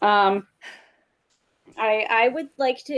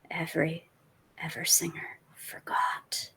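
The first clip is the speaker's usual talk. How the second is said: menacing whisper; 6 semitones lower, 8 dB quieter, slower than usual. Acted. Menacing recorded threat.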